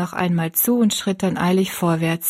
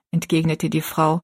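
usual speaking voice

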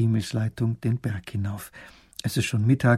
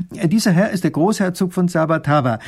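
loud, higher, ceremonious announcement; baron making an introduction; 6 semitones higher, 7 dB louder than usual. A narrator's reading. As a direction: present His Highness grandly.

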